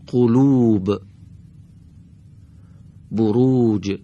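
brief first u, stretched second oo in each word; careful word-by-word Arabic model; short then long damma. Shown as a demonstration. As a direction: clip the first vowel short and hold the second. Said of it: "حرف اول این کلمات".

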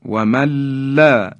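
و من لا as wamalla, held l; nun merged into lam. Shown as a demonstration. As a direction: drop the ن in و من لا.

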